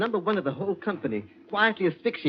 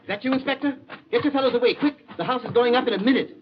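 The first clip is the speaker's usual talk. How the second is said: high, raised, hurried call; urgent warning; 10 semitones higher, 4 dB louder than usual. Acted. Captive to inspector as bomb nears, urgent warning. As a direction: call out to get them clear. Warns police of imminent explosion.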